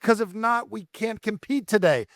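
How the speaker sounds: high, slow playful fake fret; father teasing daughter before a competition; mock worry. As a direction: pretend to dread no butterflies.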